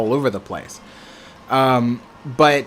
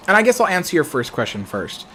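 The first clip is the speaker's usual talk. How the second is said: ordinary talk, nothing marked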